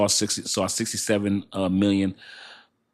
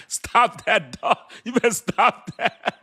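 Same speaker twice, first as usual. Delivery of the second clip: laughing tease; 13 semitones higher, 6 dB louder, slower than usual. Real, not acted. Teasing a chatter, laughing.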